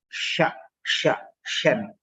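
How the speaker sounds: sha and shan with a clear sh; model sound. Shown as a demonstration. Teacher modelling the sound to fix the mistake. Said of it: "So you have to say".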